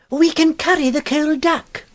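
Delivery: high, slow resolute offer; a prim lady bravely rallying; brave sister. A narrator's reading. Miss Huddle rallying her brother, high and deliberate.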